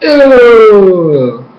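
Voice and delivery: high, loud disgusted groan; grossed out. Retold or imitated. Vlogger reacting to Amon's scar, disgusted. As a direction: recoil at the ugly scar. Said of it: "I was like".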